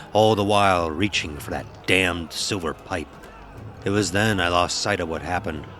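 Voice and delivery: usual speaking voice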